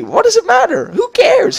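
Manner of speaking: mock the teacher in a silly high voice. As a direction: shrug it off in a squeaky put-on voice. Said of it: "She said".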